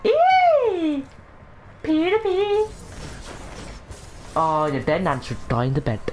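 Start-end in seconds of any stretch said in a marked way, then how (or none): 0.0-1.0 loud falsetto squeal; sudden piercing "eee"; high squeal. Pretend. Gamer squealing mid-match, high squeal. 1.8-2.7 very high falsetto; playful squeal. Pretend. Gamer being silly mid-match, playful squeal.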